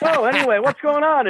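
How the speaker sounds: high, breezy, casual; Bill acting like nothing happened; cool Bill. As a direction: switch to chatty and light. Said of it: "like, cool Bill".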